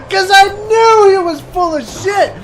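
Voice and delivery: very high, squealed aside; gleeful vindication. Acted. Comic vindicated about the young classmate, high squeal. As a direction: squeal it in mock triumph. Triumphant aside after the punchline.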